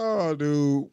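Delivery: ordinary talk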